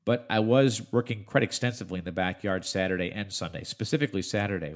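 ordinary talk

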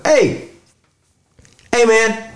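high mock call across the pumps; lightly voiced call to a stranger. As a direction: imitate a guy hollering hey man. Retelling a man calling out.

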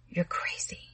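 unvoiced frightened whisper. A narrator's reading. Connie backing away from Arnold, whispering. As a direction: breathe it out in fear. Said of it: "she whispered".

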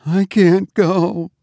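slow, high, terrified refusal; gasped. A narrator's reading. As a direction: gasp it out, faint with fear. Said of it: "gasped the woman".

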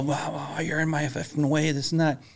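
a touch of an angry trucker; mock angry rant. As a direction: imitate the swearing guy, lightly. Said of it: "he's swearing the F-bombs".

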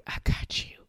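a hushed, gentle reassurance; comforting whisper. Retold or imitated. Voicing God's comforting reply.

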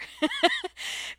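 real laugh sliding up; high squeal of a laugh. Real, not acted. Recalling asking the nurse to wait, squealing laugh. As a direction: let a squealing laugh escape.

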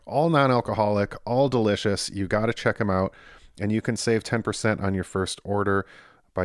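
upbeat host reading a sponsor spot. Acted. Host pitches the sponsor to listeners, upbeat ad read. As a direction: sell the beer like a podcast ad.

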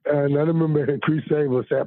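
usual speaking voice

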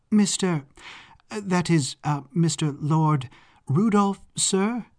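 high, halting, questioning address; flustered hesitation. Acted. Maud unsure how to address the Baron, flustered and halting. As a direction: fumble for the right title, pitch rising.

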